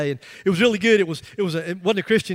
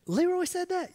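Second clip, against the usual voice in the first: high, soft disbelieving question; surprised listener voicing disbelief, much higher; incredulous listener; 11 semitones higher, 7 dB quieter than usual. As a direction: imitate someone who can't believe it. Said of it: "You said".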